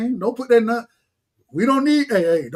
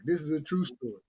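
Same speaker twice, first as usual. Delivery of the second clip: quiet aside; 6 semitones lower, 11 dB quieter than usual. Real, not acted. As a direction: lower and much softer, tossed off.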